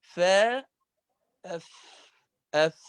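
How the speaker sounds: أف with the breath of the ف clearly audible; whispered letter demo. Shown as a demonstration. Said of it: "هذا الصوت اسمه الهمس". (نطق الفاء الساكنة في أف بجريان النفس)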